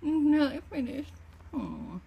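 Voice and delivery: chewing while talking; muffled words around food; mouth full. Real, not acted. Seen 2: chews after bite at -7; raises food to mouth again.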